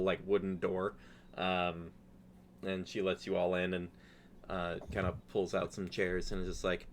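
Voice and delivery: usual speaking voice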